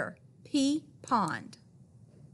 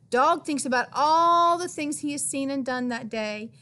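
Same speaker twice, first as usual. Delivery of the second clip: plain reading voice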